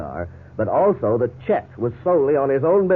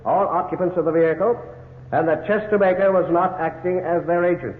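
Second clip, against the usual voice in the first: steady, raised court pronouncement; formal ruling; 7 semitones higher than usual. Acted. Judge announcing the verdict to the court, formal. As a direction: read the verdict from the bench.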